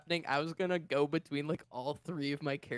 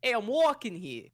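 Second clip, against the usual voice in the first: New York accent, a touch higher; 4 semitones higher, 4 dB louder than usual. Shown as a demonstration. Player warming into his New York character voice, light New York accent. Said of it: "My line is".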